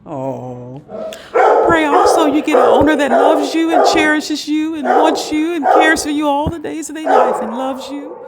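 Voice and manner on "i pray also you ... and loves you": loud, fervent prayer. Real, not acted. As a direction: pray aloud with passion.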